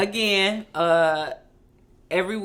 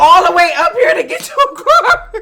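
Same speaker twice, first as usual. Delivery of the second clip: shouted through laughter, much louder and higher; laughing shout; 8 semitones higher, 13 dB louder than usual. Real, not acted. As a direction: yell it in disbelief, cracking up. Incredulous shouting about the tow.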